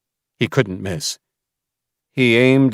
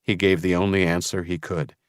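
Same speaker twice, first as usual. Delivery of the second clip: ordinary delivery, nothing marked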